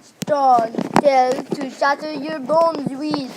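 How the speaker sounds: loud, mock British accent, slow in places; a child hamming up a British accent while yelling; big turtle taunting the crocodile in a loud British accent. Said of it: "yelled in a British voice".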